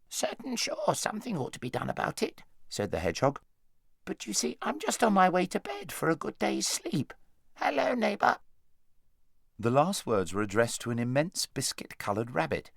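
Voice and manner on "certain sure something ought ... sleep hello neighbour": hedgehog voice. Acted.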